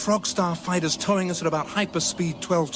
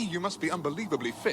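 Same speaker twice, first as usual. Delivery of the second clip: usual speaking voice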